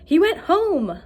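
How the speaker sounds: high, loud and thrilled; excited. Shown as a demonstration. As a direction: shout it out with excitement. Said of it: "if I was super excited that he went home".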